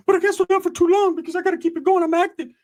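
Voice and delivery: squeaky, hyped-up excuse; high-pitched wired cousin impression; Ricky. Cousin explaining why he stays active, squeaky high voice. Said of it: "Ricky's fucking just on a good one".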